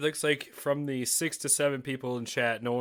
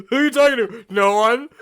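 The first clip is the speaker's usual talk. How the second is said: laughing playacting; 11 semitones higher, 14 dB louder than usual. Acted exchange, laughing.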